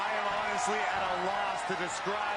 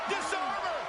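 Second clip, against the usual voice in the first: excited shout; 12 semitones higher than usual. Real, not acted. Excited commentary call.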